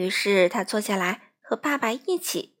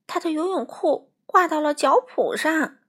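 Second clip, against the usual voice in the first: plain reading voice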